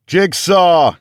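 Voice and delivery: very high, loud call; yelling as loud as possible. A narrator's reading. Captain calling for his missing man, yelling as loud as he can. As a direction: call his name at full voice. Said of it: "I yelled as loud as I could".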